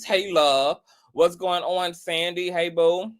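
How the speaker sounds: ordinary delivery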